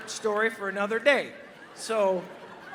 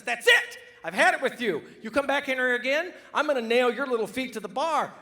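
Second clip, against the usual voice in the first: loud, higher-pitched exasperated outburst; fed-up bartender; 4 semitones higher, 5 dB louder than usual. Acted. Bartender threatening a duck who keeps asking for beer, angry and loud. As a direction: blow up at a pest, voice raised.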